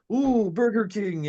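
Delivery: high, slow, drawn-out excited foreigner impression; stranded foreign guy. Acting out movie's stranded foreigner spotting fast food, high foreigner voice. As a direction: play a wide-eyed foreigner, high and slow. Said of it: "typical post-Soviet republic guy stuck in America".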